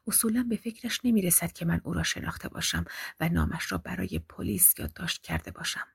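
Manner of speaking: hushed whisper of a frightened woman; whispered to herself. A narrator's reading. Tessa whispers her plan to herself, hiding by the wall. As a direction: breathe the thoughts under your voice. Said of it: "با خودش گفت".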